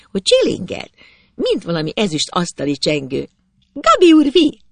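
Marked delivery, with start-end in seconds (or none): 3.7-4.6 very high, loud call; girl calling out. A narrator's reading. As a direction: sing out the boy's title from below. Girl calls up to the boy.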